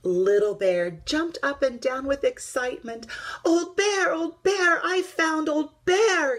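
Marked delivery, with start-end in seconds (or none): 3.4-6.4 high, loud, joyful cry; excited shout. A narrator's reading. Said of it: "he shouted".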